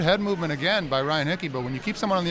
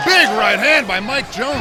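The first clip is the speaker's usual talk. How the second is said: excited play-by-play; 5 semitones higher, 9 dB louder than usual. Real, not acted. Big punch lands.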